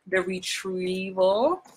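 THE RETRIEVAL stretched slowly with a rising end; drawn-out title reveal. Acted. Actor plugging her movie on Netflix, slow teasing title reveal. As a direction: draw out "The Retrieval" like a teaser.

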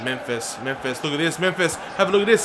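ordinary talk

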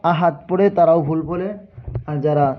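ordinary delivery

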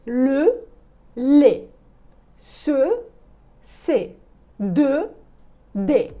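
ле, се, де each said twice, open; open sound. Shown as a demonstration. Teacher drilling open syllables with the class. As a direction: open the vowel on ле, се, де. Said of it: "Ще раз".

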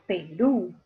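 "Perú" slowly split, pe-RÚ; stress on last syllable. Shown as a demonstration. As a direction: separate "Perú" slowly, leaning on final RÚ. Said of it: "en la última sílaba está la mayor fuerza". ("Perú" lento, marcando la última sílaba: pe-RÚ)

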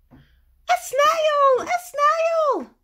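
high, loud far shriek of alarm; the teacher shrieking at the snail. Acted. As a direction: scream it in horror.

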